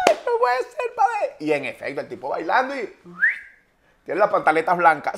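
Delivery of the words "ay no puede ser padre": much higher put-on girly voice, coquettish; coy woman.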